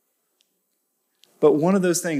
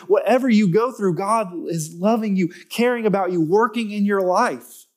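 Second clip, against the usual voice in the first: preaching at full force; 6 semitones higher than usual. Acted. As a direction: lift the room with rising conviction.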